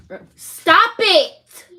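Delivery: a mother at the end of her rope, screaming stop it; furious shout. Real, not acted. Mom yells at kids interrupting.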